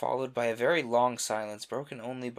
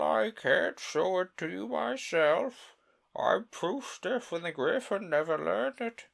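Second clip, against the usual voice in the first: slow, high, mournful turtle voice; a weepy old turtle settling in to tell his tale; mock turtle voice; 7 semitones higher, 6 dB louder, slower than usual. The Mock Turtle to Alice, slow and high.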